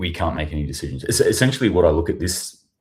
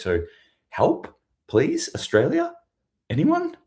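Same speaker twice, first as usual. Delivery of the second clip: whiny, pleading mock official voice climbing much higher; higher and higher helpless mock plea; mocking helpless government; 6 semitones higher than usual. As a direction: play a clueless government begging for help, pitch rising to a desperate squeak. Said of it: "our government has just come out and said".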